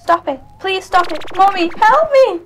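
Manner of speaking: rising, loud frightened pleading; terrified pleading. Acted. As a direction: beg in terror. Terrified girl cries for help.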